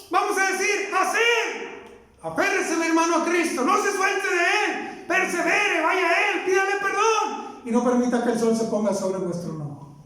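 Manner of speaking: high, shouted exhortation; fiery preaching. Acted. Preacher exhorting struggling believers, shouted pulpit preaching. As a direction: urge the flock to hold on to Christ.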